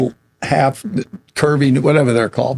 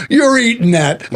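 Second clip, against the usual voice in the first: higher, firm mom voice with a laugh; stern Italian mom; 7 semitones higher than usual. Retold or imitated. Italian mom telling her son he eats it. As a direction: lay down the law like a mother.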